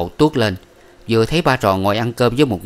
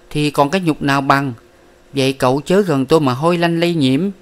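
scornful; 6 semitones higher than usual. A narrator's reading. Miss Ba scorns the disowned young man, higher. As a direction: push him away with scorn.

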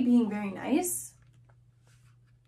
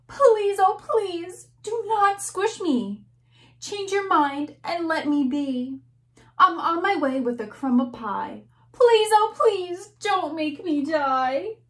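pitched-up, begging little ant; pleading ant; 4 semitones higher than usual. Acted. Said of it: "The ant says".

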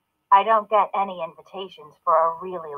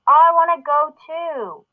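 screaming little sister; 7 semitones higher than usual. A narrator's reading. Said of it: "Sana screams".

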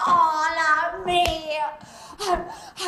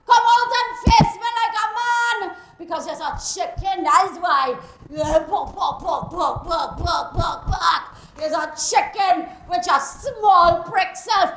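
angry Caribbean woman yelling curses at a man's house; furious cursing woman; 11 semitones higher, 8 dB louder than usual. Acted. Jilted woman outside Selwyn's house, furious yelling. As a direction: rage at him, loud and raw.

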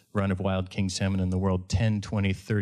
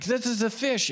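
high, excited explaining; urgent exclamation; 14 semitones higher than usual. Retold or imitated. Guide tells confused Art about the fish, urgent and high. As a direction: re-enact exasperated urgency.

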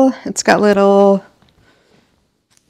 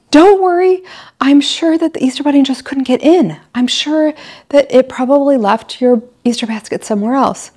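reassuring mom; 7 semitones higher than usual. Retold or imitated. Mom reassuring her kids the bunny couldn't get in, high and bright. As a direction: cover the slip with bright cheerful comfort. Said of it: "And I was like".

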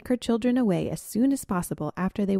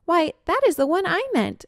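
much higher, surprised; surprised exclamation; 11 semitones higher, 5 dB louder than usual. Acted. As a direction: burst out in surprise. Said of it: "exclaimed the Spotted Skunk".

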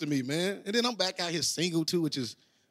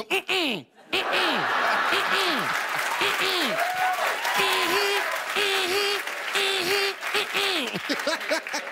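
high, sing-song mm-mm hums; app rejection hums; 13 semitones higher, much slower than usual. Retold or imitated. Comic imitating the dating app's sounds, high hums. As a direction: hum no like a phone app's buzzer. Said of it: "it got sounds to it".

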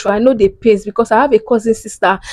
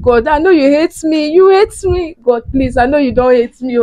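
higher voice, breaking into crying as she pleads; tearful plea to God; 5 semitones higher than usual. Retold or imitated. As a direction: beg God through rising tears, voice cracking. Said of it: "I was telling God".

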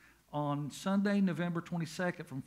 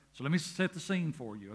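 ordinary delivery

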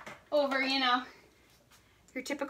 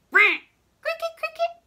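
short roar, then high cricket chirps; mocking cricket; 12 semitones higher, 4 dB louder than usual. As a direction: imitate a taunting cricket. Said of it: "It's literally sitting there like".

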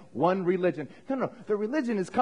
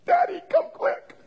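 put-on child calling. Child calls dad, acted scene.

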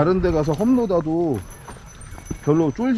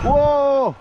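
excited shout; 9 semitones higher, 4 dB louder, much slower than usual. Real, not acted. Riding down a steep trail.

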